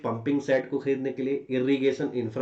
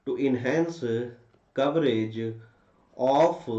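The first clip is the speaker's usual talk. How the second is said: very slow, word-by-word dictation; dictating; much slower than usual. Shown as a demonstration. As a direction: spell out the answer phrase by phrase. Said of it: "आप ये भी लिख सकते हो".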